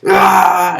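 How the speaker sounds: loud, high hammed-up angry yell; mock rage roar. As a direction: roar like he's hulking up. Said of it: "Batista will be like".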